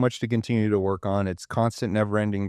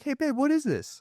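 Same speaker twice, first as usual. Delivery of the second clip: high, light questioning wife voice; imitating wife; 13 semitones higher, 3 dB quieter than usual. Husband voices his wife finding foil, high puzzled mimic. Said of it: "she'd find foil in the bathroom, be like".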